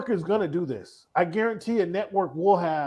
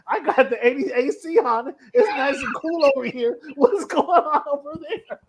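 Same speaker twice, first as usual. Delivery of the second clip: high voice, laughing through the lines; laughing Peyton; 9 semitones higher than usual. Retold or imitated. Peyton laughing as he jokes about his AC. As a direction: play it up through giggles. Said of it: "He's like".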